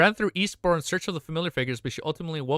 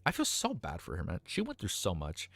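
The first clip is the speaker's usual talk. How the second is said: soft, low, sad; quiet real sympathy for her; hushed and moved; choked up; 8 semitones lower, 9 dB quieter than usual. Real, not acted. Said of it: "I'm getting emotional".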